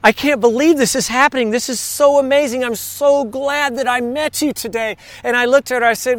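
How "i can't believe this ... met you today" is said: a hint of a thrilled woman who just met Jesus; amazed German woman. Stewardess in the water thanking the preacher, lightly amazed. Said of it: "she just looked at me and she said".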